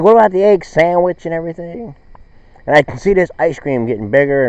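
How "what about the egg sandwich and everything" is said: higher, louder greedy customer voice; lightly voiced hungry woman in line; mock eager customer. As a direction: ask for it like she wants everything on the menu. Woman ordering, raised pitch.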